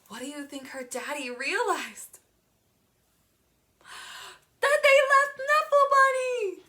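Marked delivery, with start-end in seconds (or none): 4.6-6.6 high, loud alarmed cry; alarmed exclamation. A narrator's reading. Reader shouting the shocking realization to the child, high and loud. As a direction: gasp it out like a disaster.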